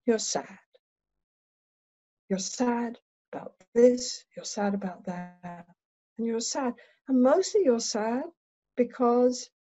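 hushed and unhurried, long pauses between phrases; slow, soft therapist voice. Shown as a demonstration. Therapist telling her client she seems sad, slow and soft. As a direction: go gently, letting each phrase sink in. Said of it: "So I slow down".

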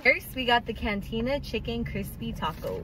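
soft, hushed car review; quiet voice. Real, not acted. Quiet taste-test talk in the car.

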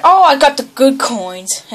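ordinary talk, nothing marked

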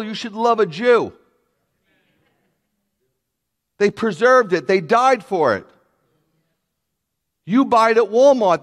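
ringing sermon voice; full-force preaching. Acted. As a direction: drive the point home to the congregation.

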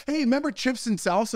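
high, bright mimic of the wife; mock sing-song wife. Husband imitating his wife's shower question, high and chirpy. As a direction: chirp it like her.

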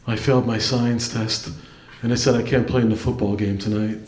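low, quick, dim big-brother impression; dopey brother voice. Little brother telling his sibling the news, low and dopey. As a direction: play him low and earnest, a bit dense. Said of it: "He's like".

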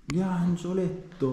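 high, drawn-out delighted cry; excited squeal. Real, not acted. Spotting a cute cherub fresco.

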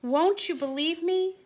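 high, pleading donkey voice; a small voice begging to be believed; sad cry. A narrator's reading. Sad donkey pleading with the man. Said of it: "the sad donkey cried".

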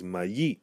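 "mayi" as ma-YEE, stress on the end; "mayi" with a stressed final yee; stress on final syllable. Shown as a demonstration. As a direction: lift the last syllable of "mayi". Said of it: "where we stress the final syllable".

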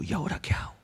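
panicked whisper. Acted. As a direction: whisper it like someone who just killed a man.

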